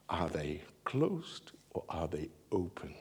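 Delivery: soft whispered question. Real, not acted. Preacher asking the congregation softly, in a whisper. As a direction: ask it in a hush.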